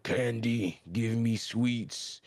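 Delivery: feral candy-craving voice; Rudo. Retold or imitated. Rudo gone feral for candies, feral drawn-out voice. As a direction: drag it out like a sweets-crazed creature. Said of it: "Rudo kinda goes feral".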